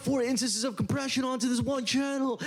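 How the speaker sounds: very high, sing-song mocking voice; mock them in a silly high singsong; mocking podcaster. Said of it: "Like, it's like".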